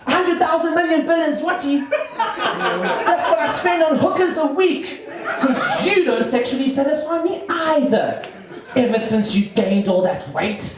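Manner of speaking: high-pitched husband firing back in a quarrel; outraged husband. Acted. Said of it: "the husband's gonna go".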